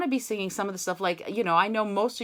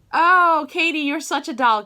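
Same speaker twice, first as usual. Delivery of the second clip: loud, high, gushing thanks; real burst of affection; delighted gratitude; 8 semitones higher, 9 dB louder than usual. Real, not acted. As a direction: gush fondly, pitched up and loud. Thanks helper for sharing the link.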